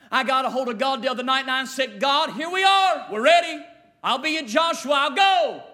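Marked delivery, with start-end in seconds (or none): 2.0-5.6 a preacher crying out his readiness to God; shouted prayer. Retold or imitated. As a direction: call out ready to go.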